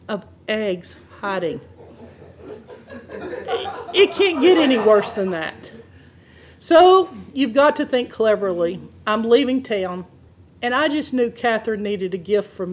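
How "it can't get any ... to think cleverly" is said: normal talking voice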